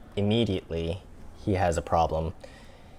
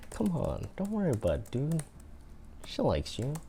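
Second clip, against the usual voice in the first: high Boston buddy voice; Boston accent; 7 semitones higher, 4 dB quieter than usual. Pretend. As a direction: reassure him, Boston style. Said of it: "if that's a Boston accent".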